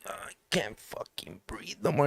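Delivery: whispered, airless gasp of panic; barely any breath left to say it; strained whisper, running out of air; breathless whisper. Retold or imitated. Suffocating during ceremony.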